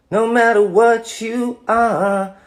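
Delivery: very high, loud and slow, drawn-out; dramatic swelling line. Pretend. Actor answers a fan's request with a high, slow theatrical vow. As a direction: stretch it out like a big theatrical vow. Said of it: "Can you say something along the lines".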